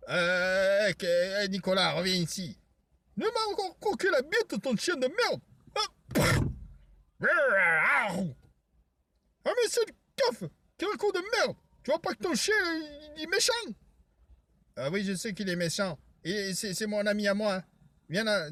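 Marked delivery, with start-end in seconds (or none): 3.2-13.5 high, shrill-free cartoonish yelling voice; high squeaky voice. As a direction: pitch way up like a cartoon. High-pitched character voice.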